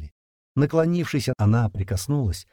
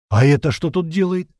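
higher, louder, taken aback and cross; stunned, angry exclamation; 7 semitones higher, 6 dB louder than usual. A narrator's reading. Yevgeny reacts to her arrival, stunned and angry. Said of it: "ошеломлённо и зло воскликнул Евгений".

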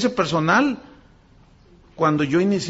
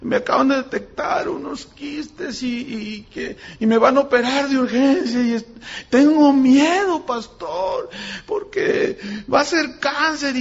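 crying caller; 7 semitones higher, slower than usual. Retold or imitated. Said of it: "alguien estaba llorando".

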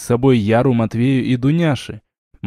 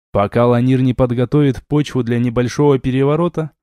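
usual speaking voice

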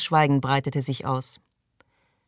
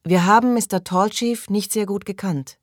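higher-pitched leading prayer line; prayer leader; 6 semitones higher, 4 dB louder than usual. Acted. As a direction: lead the group in prayer, pitched up. Leader recites prayer, others repeat. (vorbetend, mit erhobener Stimme)

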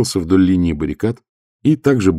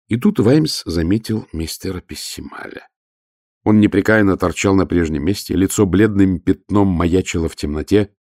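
plain reading voice

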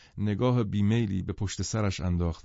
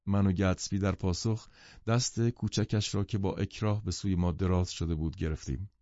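ordinary delivery, nothing marked

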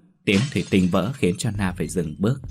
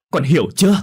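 high, loud "do you understand"; mother yelling at her daughter; loud demand; 8 semitones higher, 8 dB louder than usual. A narrator's reading. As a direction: shout it as an ultimatum.

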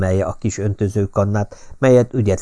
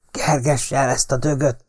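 angry shout; 5 semitones higher than usual. A narrator's reading. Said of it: "kiáltotta le a fáról mérgesen".

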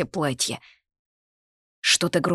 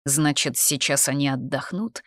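plain reading voice

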